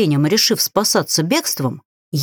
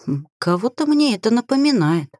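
usual speaking voice

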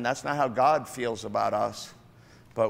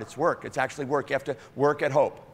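ordinary talk, nothing marked

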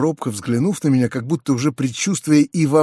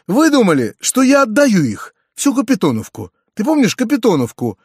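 lightly voiced uncle, pitched up and bustling; lively, higher voice for a hurried uncle; flustered uncle; 6 semitones higher, 4 dB louder than usual. A narrator's reading. Uncle explains to his nephew about giving away the village, flustered.

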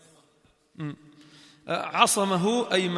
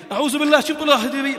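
louder, higher and fast mock shock; mock outrage; 6 semitones higher, 5 dB louder, much faster than usual. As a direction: feign horror at the request. (استنكار مصطنع بصوت أعلى وأسرع)

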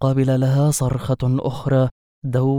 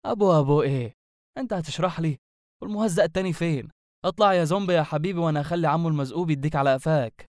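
higher, quick sarcastic telling-off; mocking scolding; 4 semitones higher, 3 dB quieter, faster than usual. Sorcerer orders the hidden zombie out, mocking scolding. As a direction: needle him with mock sweetness and threat.

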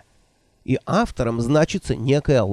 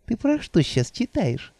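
put-on higher, fluttery old woman voice; 9 semitones higher, 5 dB quieter than usual. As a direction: play the flustered old witch. Nanny voiced by narrator.

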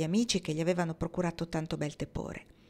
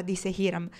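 usual speaking voice

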